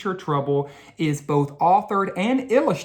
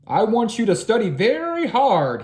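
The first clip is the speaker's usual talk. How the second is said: teacher's order; 6 semitones higher, 4 dB louder than usual. A narrator's reading. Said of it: "he said to the class".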